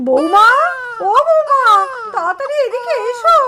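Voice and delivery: loud, rising call for someone in another room. Acted. Grandmother summoning her daughter-in-law to the crying baby, calling out. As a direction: call out urgently for help.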